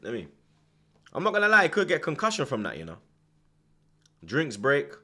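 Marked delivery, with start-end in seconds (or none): none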